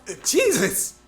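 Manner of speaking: loud, high startled cry; startled yelp. Acted. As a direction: jump at the surprise. Startled by sudden greeting.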